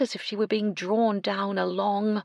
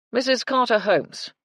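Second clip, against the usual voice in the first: high, loud public announcement; 7 semitones higher, 7 dB louder than usual. Acted. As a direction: call the name across the lobby. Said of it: "announced to anyone within earshot".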